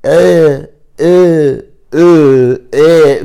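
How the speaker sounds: إيه sung on four different Chinese tones, loud; Chinese tones demo. Shown as a demonstration. Showing listeners Chinese tones, إيه on four tones. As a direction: run through إيه on each tone in turn. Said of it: "لأنه فيها آ".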